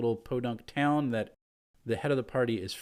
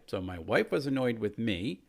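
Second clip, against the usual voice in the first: ordinary delivery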